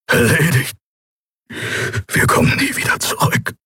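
whispered despair; spent, hopeless whispering; defeated whisper. A narrator's reading. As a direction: give up in a voiceless whisper. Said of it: "flüsterte Kero".

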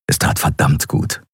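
ordinary delivery

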